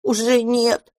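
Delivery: put-on gloomy child's retort; sullen. A narrator's reading. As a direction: reject it with a pout. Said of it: "хмуро произнёс мышевраг".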